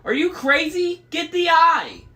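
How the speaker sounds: very high, loud retort; frantic yell. A narrator's reading. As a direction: shout back in outraged panic. Frantic retort during the chaos.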